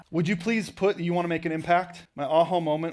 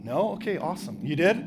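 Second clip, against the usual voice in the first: normal talking voice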